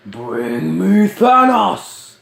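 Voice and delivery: acted Thor shout. Kid quoting Thor's battle cry.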